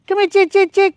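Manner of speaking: high-pitched chicken call. Real, not acted. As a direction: call the birds in, high and singsong. Calling chickens to come.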